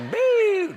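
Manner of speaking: high, loud "boo" swoosh; imitated zoom noise. Ambulance dropping him at the restaurant, cartoon zoom. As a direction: hoot out a quick cartoon sound effect.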